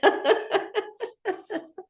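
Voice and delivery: high rolling laugh; long high giggle. Real, not acted. Laughing at visa struggle.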